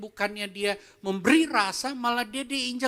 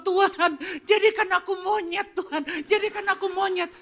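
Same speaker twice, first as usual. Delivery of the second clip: mock pleading monkey; 7 semitones higher than usual. Preacher mocking a monkey that begs God to be a monkey. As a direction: ham up a desperate plea. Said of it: "nangis-nangis dan dibilang".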